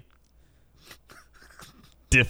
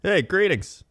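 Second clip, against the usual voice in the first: much higher, bright "Hey, greetings"; cheery greeting; 9 semitones higher than usual. Shown as a demonstration. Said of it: "you could be like".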